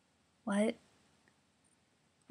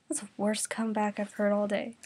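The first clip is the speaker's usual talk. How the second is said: ordinary delivery, nothing marked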